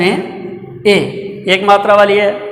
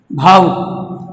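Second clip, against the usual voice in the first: slow drawn out; much slower than usual. Shown as a demonstration. Teacher says भावुक slowly for students to hear. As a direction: say भावुक very slowly, stretching भा and वुक.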